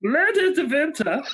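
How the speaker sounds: hammed-up German with a V for W in Winter; German accent. Quiz host asked to read the next question, mock German accent. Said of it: "in a German accent".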